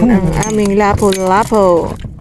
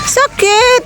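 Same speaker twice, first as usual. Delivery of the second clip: put-on high, sing-song baby voice; baby talk echo; 14 semitones higher than usual. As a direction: mirror a hurting toddler, coaxing. Echoing a crying child. (boses-bata na panggagaya)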